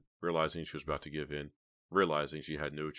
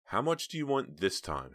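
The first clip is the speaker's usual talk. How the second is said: higher, reluctant question; 6 semitones higher, 3 dB louder than usual. A narrator's reading. Heather asks the blackmailer his price, reluctantly. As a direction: ask it against your will. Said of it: "Heather asked reluctantly".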